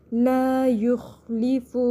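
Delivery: slow لا یخلف with long "laa" and full, heavy kha; measured tajweed recitation. Shown as a demonstration. Teacher models for students, لا یخلف recited slowly with thick kha. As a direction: chant لا یخلف, stretching the first vowel. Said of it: "زبر کو جلدی چھوڑنا ہے".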